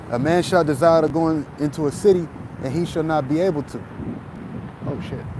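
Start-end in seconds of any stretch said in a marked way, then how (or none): 4.9-5.2 muttered under breath. Real, not acted. Sudden aside while out walking.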